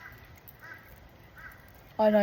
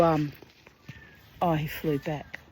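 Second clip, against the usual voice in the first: normal talking voice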